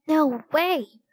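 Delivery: high, emphatic refusal; through gritted teeth. A narrator's reading. Annie refusing to Jack, teeth gritted. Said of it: "gritting her teeth".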